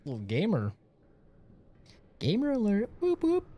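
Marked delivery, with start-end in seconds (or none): 2.2-3.5 high whooping siren call; mock siren. Real, not acted. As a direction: whoop like an alarm.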